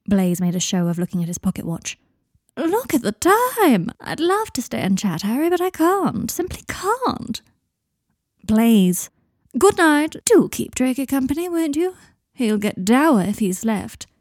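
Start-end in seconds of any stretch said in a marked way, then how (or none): 2.6-14.1 much higher, hammed-up, sliding to a hushed "simply can't"; a sly friend faking a hurry to leave them alone; Blaise's theatrical exit. A narrator's reading. Blaise makes a show of leaving.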